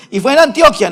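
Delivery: Antioquia stressed on the o, gliding -kya ending; imitated Colombian mispronunciation. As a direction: mimic someone saying an-TIO-kya for Antioquia. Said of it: "escucho al colombiano diciendo".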